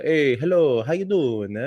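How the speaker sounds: mock Italian-accented hello. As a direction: greet like a cool Italian, accent laid on. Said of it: "Italians sound fucking cool".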